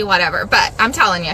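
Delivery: ordinary talk, nothing marked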